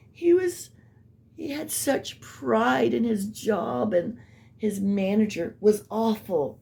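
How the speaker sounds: high, slow, doting imitation of her; a sugary, mournful tribute; mock sad and loving. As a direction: play her fond, sorrowful act. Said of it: "she acts sad, like, loving".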